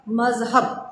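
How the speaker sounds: normal talking voice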